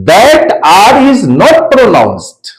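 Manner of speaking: drawn-out, word by word; slow teacher dictation. Shown as a demonstration. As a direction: spell the rule out very slowly. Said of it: "If the final sound of any word is R".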